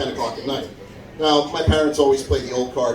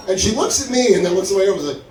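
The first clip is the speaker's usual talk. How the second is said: usual speaking voice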